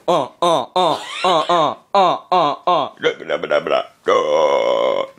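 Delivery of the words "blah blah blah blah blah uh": deep voice filter. Acted.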